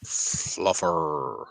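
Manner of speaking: long drawn-out West Country Fluffer with rolling final r; West Country accent. Shown as a demonstration. Speaker showing how Fluffer must be said, West Country accent. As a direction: demonstrate a broad West Country accent, falling slowly. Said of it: "say it in a West Country accent".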